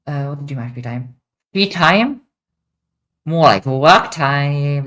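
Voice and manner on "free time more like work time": mock outrage. Real, not acted. Mock outrage at free time.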